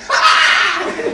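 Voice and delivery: sudden high, loud yelp of panic; mock group scream. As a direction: scream like a crowd fleeing in terror. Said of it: "at one voice they said".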